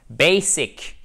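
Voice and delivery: "Basic" stressed on BA, final sic still clear; first syllable stress. Shown as a demonstration. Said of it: "on entend le hic tout de même à la fin". (Basic, accent sur BA, fin bien détachée)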